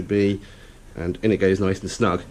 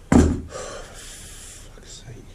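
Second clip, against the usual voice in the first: whispered curse; 4 dB quieter than usual. Real, not acted. Glue mishap, muttered curse.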